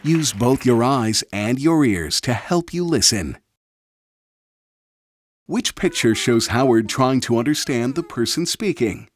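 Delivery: normal talking voice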